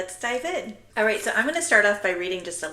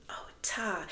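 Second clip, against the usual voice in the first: whisper; 8 dB quieter than usual. Retold or imitated. Voicing students' answer.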